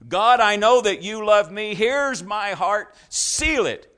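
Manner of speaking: impassioned prayer. Acted. Impassioned prayer voiced in sermon.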